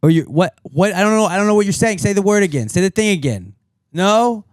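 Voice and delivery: high, loud, baffled mock phone call; confused phone voice. Acting out a confused DoorDash call, loud and baffled. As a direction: play a lost caller who can't understand a word.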